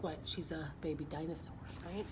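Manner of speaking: usual speaking voice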